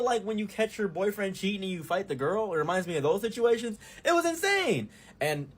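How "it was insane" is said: high exclaim. Real, not acted.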